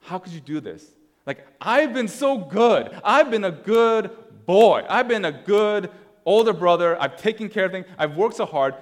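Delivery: high, indignant self-pitying protest; aggrieved older brother. Acted. Older brother complaining to the father, loudly aggrieved. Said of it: "what does the older brother say".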